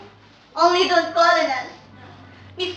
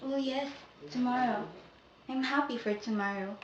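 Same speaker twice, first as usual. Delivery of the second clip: much softer, lower fading voice; fading whisper-soft; 10 semitones lower, 17 dB quieter than usual. Acted. Sabina promises George to wait, fading soft voice. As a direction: drift away as you speak.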